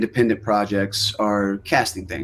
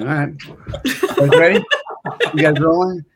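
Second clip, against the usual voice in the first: higher, louder hyped-up call to the crew; pumped call-out; 7 semitones higher, 5 dB louder than usual. Retold or imitated. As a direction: fire up the camera crew before the climb. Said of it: "And I was like".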